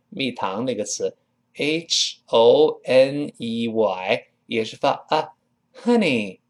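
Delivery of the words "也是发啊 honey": ordinary talk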